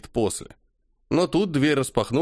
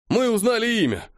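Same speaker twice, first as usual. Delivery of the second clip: excited exclamation; 11 semitones higher than usual. Acted. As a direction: announce the discovery eagerly. Messenger exclaims.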